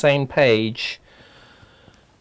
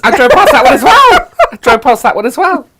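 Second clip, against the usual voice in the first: laughing; 11 semitones higher, 10 dB louder than usual. Real, not acted. Laughing about town name.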